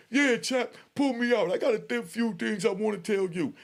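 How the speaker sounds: high, loud taunting shout; mock hollering. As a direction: holler it down the tier with swagger. Inmate hollering at the psych.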